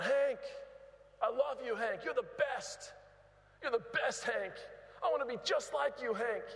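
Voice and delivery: high eager fan calling out; yelling fan. Retold or imitated. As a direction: yell adoringly from the stands. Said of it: "this man would yell out".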